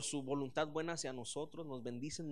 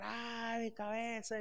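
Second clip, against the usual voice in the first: mock crying; 7 semitones higher, much slower than usual. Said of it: "yo empecé a llorar".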